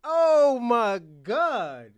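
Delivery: high, loud, drawn-out outcry; loud exclamation. Real, not acted. Reacting after laughing hard, loud drawn-out exclamation. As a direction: stretch it out slowly and loudly.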